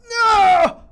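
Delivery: sudden high, loud yell of dismay; dismayed shout. Real, not acted. Player yelling at the game as the run fails, a high, loud shout.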